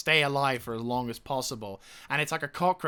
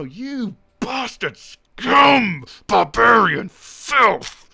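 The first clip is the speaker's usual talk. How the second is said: much higher, much louder, slow roaring insults; furious shout; 10 semitones higher, 14 dB louder, much slower than usual. Real, not acted. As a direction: bellow the insults at the barbarians, drawn out. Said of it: "Like, right now".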